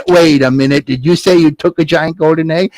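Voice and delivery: ordinary delivery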